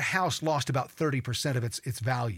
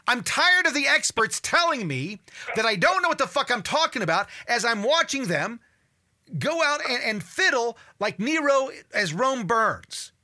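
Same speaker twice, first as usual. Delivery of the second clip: louder, higher fed-up tirade; angry rant; 6 semitones higher, 4 dB louder than usual. Real, not acted. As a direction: vent your fury at the experts, loud and raised. Ranting against the experts.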